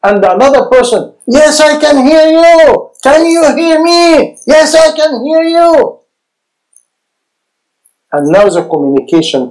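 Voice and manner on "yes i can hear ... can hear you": eager listener answering. Acted. Acting out two people hearing each other.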